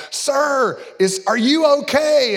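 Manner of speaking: high-pitched, mock-urgent calling out; worried guide. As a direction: imitate a guide calling to an older man. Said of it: "the guide is there and they're like".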